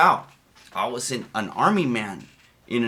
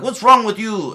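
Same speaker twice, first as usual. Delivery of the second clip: higher, louder mock yell; drill sergeant yell; 9 semitones higher, 8 dB louder than usual. Sergeant yelling at a recruit doing push-ups, mock yell. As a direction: snap it at the recruit. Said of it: "they're like".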